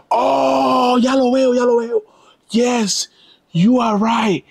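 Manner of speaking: finally spotting it and reeling in disbelief; shocked realization. Real, not acted. Sees the photo, realizes shock.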